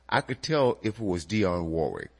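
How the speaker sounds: ordinary talk, nothing marked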